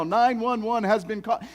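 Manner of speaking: mock alarm warning. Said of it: "began saying something to the effect of this".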